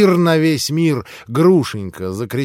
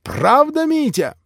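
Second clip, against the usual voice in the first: much higher, louder indignant shout; outraged Polish suitor shouting; high indignant yell; shouting pan; 6 semitones higher, 3 dB louder than usual. Acted. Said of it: "закричал пан".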